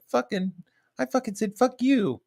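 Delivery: very high-pitched mock voice; high, derisive parody of an outburst; mocking imitation of her. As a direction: mimic her in a squeaky falsetto. Mimicking her losing control.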